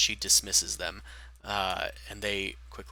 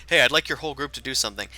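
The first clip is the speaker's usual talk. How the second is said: rushed, louder mock request; fast mock quest-giver; 4 semitones higher, 7 dB louder, much faster than usual. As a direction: rattle off a fake request.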